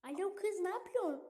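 put-on lightly high girly voice; a hint of a girl's voice. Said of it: "Kız sesi yapacağım". (hafif kız sesi)

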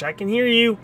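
high teasing I can hear you; high sing-song taunt. Pretend. Taunting repeated line.

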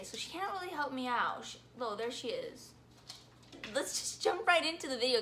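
ordinary delivery

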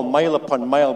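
usual speaking voice

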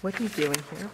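low, soft mumble off the mic; quietly rehearsing the line while lost; muttered to herself. Real, not acted. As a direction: mumble the line to find your place. Muttering to find her place.

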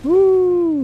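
high, loud whoop of hype; excited whoop. Real, not acted. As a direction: let out a big joyful woo. Hype yell after a play.

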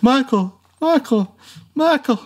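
high, rising panicked repeats of a name; freaked-out Michael calls. Retold or imitated. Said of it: "weird freak out where he's like".

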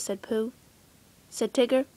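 usual speaking voice

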